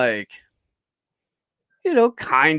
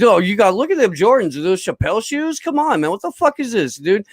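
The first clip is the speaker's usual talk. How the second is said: mocking roast; 7 semitones higher, faster than usual. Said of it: "goes off on".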